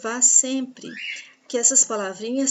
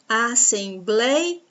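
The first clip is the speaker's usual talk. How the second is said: "Assembleia" slowly split, long stressed BLEI; teacher sounding out as-sem-BLEI-a; syllable-by-syllable demo; much slower than usual. Shown as a demonstration. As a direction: say "Assembleia" slowly, stretching "blei" before the final "a". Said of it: "O blei, penúltima sílaba".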